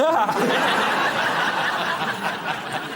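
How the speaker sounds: drawn-out breathy laugh at his own joke; real amused laughter; long laugh. Real, not acted. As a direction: laugh it off, pleased with the line.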